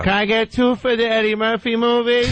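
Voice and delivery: high, eager ticket request; mock moviegoer. Host mimicking a moviegoer buying tickets. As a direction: ask like a clueless fan. Mocking moviegoer at box office.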